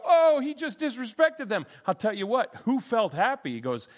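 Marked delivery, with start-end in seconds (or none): 0.0-1.7 mock objection. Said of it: "you go".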